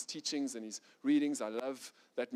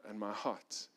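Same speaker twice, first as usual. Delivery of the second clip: softer and lower, quiet surrender to God; humble prayer; 6 semitones lower, 8 dB quieter than usual. Acted. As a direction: pray it gently, almost under your breath.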